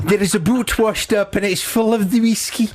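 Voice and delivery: high-pitched, posh English attempt at Scottish; bad Scottish accent. Radio host imitating posh English actress failing at Scottish accent. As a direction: put on a badly faked Scottish accent. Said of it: "posh English woman trying to do this Scottish accent".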